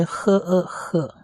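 呵 and 呃 apart, then blended into 呵; sounding out syllables. Shown as a demonstration. Teacher blends consonant and vowel, sounding out 呵呃呵. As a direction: slowly join 呵 plus 呃 into 呵. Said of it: "母音是呃的音".

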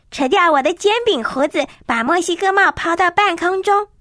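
ordinary delivery, nothing marked

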